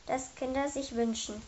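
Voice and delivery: ordinary delivery, nothing marked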